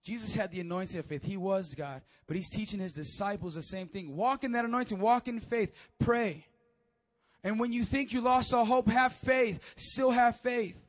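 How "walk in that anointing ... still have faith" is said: loud, raised exhortation; emphatic pulpit commands; forceful preaching. Acted. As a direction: urge the congregation on with force.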